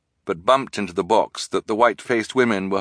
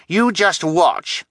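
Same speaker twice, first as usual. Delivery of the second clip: slow, high, loud challenge; insistent; 11 semitones higher, 5 dB louder, much slower than usual. A narrator's reading. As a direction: insist it as a dare. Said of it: "Kevin insisted".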